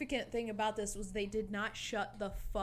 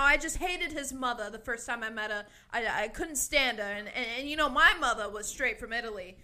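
louder put-on New York accent, chatty old lady; mock old New Yorker; 2 semitones higher, 10 dB louder than usual. Mocking an old breakfast guest's endless chatter, put-on New York accent. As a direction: imitate the chatty old Italian-American guest. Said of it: "these old people are talking about".

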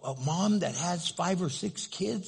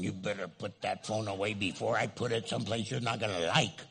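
low threat; 8 semitones lower, 5 dB quieter than usual. Retold or imitated. Mom threatening child over phone.

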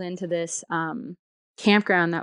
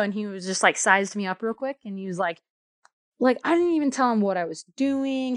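ordinary talk

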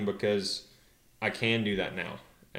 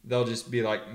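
normal talking voice

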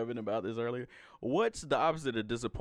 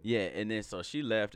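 usual speaking voice

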